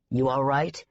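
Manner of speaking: "You all right?" low and savage; low, fierce worried growl. A narrator's reading. Mick fiercely checking on Daisy, low and savage. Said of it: "His voice was savage".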